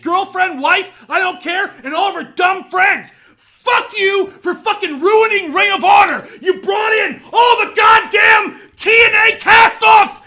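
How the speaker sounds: loud, high and raging, near cracking; real fury, voice breaking at the top; furious shouting rant. Real, not acted. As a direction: scream it at the man who ruined his promotion. Furious rant at Bully Ray.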